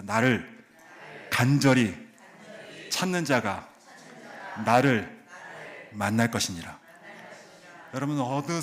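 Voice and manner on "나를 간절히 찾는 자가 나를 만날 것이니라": word by word, each word punched loud; call and response. Shown as a demonstration. 한 단어씩 힘주어 따라 읽기. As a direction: pastor leads congregation phrase by phrase, loud and paced. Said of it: "따라해 보겠습니다".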